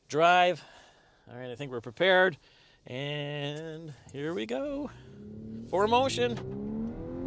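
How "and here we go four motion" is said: drawn-out announcement. Real, not acted. Launching the acceleration test.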